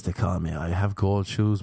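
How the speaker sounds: ordinary talk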